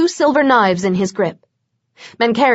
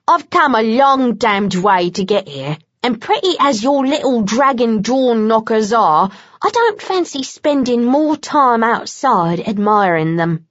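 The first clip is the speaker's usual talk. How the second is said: Bones, Cockney. A narrator's reading. Bones mocks Vlad's door knockers in a light Cockney voice. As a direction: tease him in a Cockney lilt.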